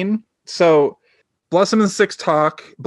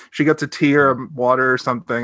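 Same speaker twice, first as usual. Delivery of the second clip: ordinary talk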